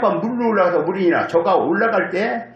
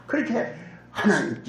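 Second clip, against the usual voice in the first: usual speaking voice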